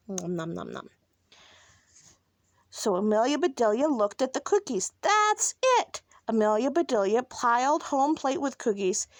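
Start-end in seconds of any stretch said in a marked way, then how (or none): 0.1-0.9 low, soft nom-noms; quiet little eating sounds; munching noises. A narrator's reading. Reader imitates munching. 5.0-6.0 high, delighted exclamation; sudden realisation. A narrator's reading. Character has sudden idea.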